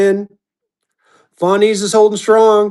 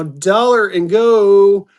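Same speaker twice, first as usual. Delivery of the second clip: long drawn-out GO; sing-out sales call, slow and stretched; auctioneer's opening call; 3 dB louder, much slower than usual. Real, not acted. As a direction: holler it out slowly, stretching the last word. Auctioneer calling the opening bid.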